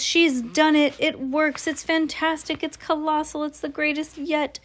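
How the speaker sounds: high-pitched gushing acclaim; excited cheering. A narrator's reading. Witch audience praising their leader, excited high cheering. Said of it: "yelled the audience".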